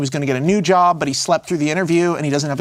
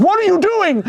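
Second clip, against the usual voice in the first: much higher, exasperated cry; mock exasperated outburst; 13 semitones higher, 3 dB louder than usual. Man protests to ghost about his ruined umbrella, mock exasperated. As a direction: play up the outraged disbelief.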